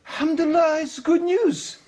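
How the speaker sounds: high-pitched imitation of a delighted wife; mock delight. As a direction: exclaim it as joyful relief, very high. Wife's delighted reply in the joke.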